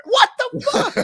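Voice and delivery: high, laughing what the; laughing disbelief. Real, not acted. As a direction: squeal it in outrage.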